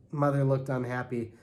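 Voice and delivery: ordinary delivery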